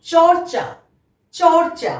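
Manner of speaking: teacher modelling চর্চা with a clear র; model pronunciation. Shown as a demonstration. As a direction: sound র clearly before the second চ in চর্চা. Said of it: "ঠিক একইভাবে".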